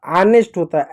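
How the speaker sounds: usual speaking voice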